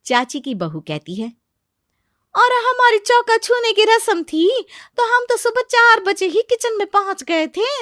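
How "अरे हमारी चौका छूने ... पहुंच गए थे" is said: very high, loud boasting; boastful daughter-in-law. Acted. Aunt's daughter-in-law compares her own kitchen ritual, boastful. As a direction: brag in a loud, high-pitched character voice.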